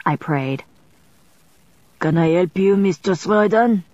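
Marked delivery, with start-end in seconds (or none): 2.0-3.8 put-on light French accent on Mister Sweden; a scrivener greeting her in French-accented English; French accent, lightly done. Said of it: "he said in a French accent".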